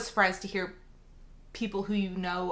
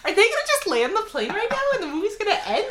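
rushing, much higher and louder, laughing; half-shrieking hope that the movie ends now; excited disbelief; 13 semitones higher, 7 dB louder, faster than usual. Retold or imitated. Co-host reliving hoping the plane lands, high laughing excitement. Reliving nail-biting landing scene.

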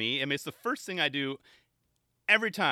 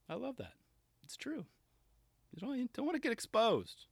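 high squeaky voice; 10 semitones higher, 4 dB quieter than usual. Acted.

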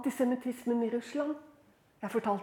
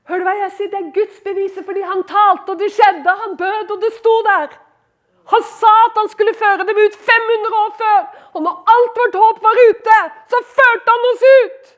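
loud, high-pitched preaching at full force; really belting it out; 9 semitones higher, 13 dB louder than usual. Real, not acted. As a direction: build to a shouted climax. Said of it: "Jeg måtte bare ta i litt".

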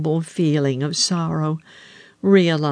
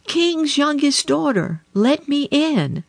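high calling frog voice; frog crying at door; 11 semitones higher than usual. A narrator's reading. Frog at the palace door calling the princess, high. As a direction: call through the door, pitched up high. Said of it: "a voice crying".

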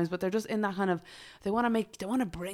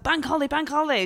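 mock excited chant, higher; excited cheer; 8 semitones higher, 3 dB louder than usual. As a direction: cheer it like a holiday. Imitating excited people.

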